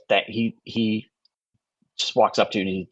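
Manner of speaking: normal talking voice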